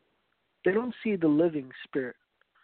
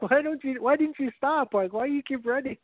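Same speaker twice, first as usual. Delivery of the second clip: higher-pitched mock exasperation; retold exasperated plea, pitched up; exasperated questioning; 7 semitones higher than usual. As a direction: imitate a baffled chaser pleading for an answer. Retold chaser questions the runaway kid.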